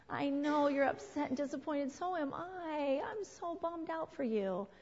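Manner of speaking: tender, sympathetic lilt; sympathetic parent. Acted. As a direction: draw it out gently, feeling for the child. Models sympathy instead of yelling back.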